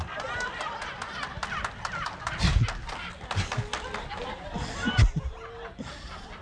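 long breathless laugh climbing very high; real laughter. Real, not acted. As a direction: wheeze with laughter at the memory. Laughs about being scared.

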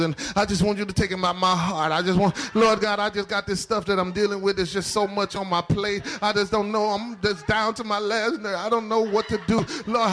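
imitated pleading prayer. Preacher mimicking how we pray for relief, pleading. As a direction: parody someone begging God for relief. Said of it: "You know how we pray".

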